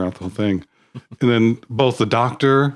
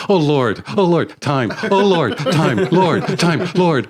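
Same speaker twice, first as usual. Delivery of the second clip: higher, rhythmic chant of Oh Lord; mock lamenting; 7 semitones higher, slower than usual. Somebody over a dead body near the Doctor, mock lamenting. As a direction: repeat it like a hammed-up wailer, amused.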